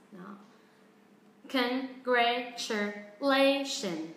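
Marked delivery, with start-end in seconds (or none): 1.5-4.1 Congratulation drawn out very slowly; slow drill of Congratulation; very slow model. Shown as a demonstration. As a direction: say Congratulation at a crawl. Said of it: "bắt đầu tăng tốc".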